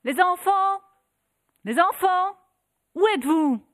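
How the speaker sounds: high, loud desperate call; mother calling out. Acted. Mother searching the house for her children, calling loudly. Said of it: "et cria".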